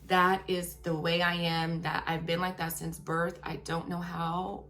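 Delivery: ordinary delivery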